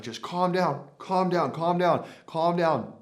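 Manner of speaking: high, imitated soothing of a lift guy; a lightly put-on voice of a lifty talking someone down; lift operator calming. Lift operator calming a screaming injured teen, in a high imitated voice. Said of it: "a guy running the lift saying".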